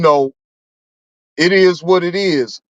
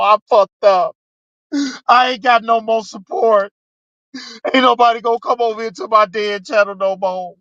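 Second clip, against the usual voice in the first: mock whining; 4 semitones higher than usual. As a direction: fake a dramatic meltdown, half-shouting. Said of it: "I ain't gonna sit up here and".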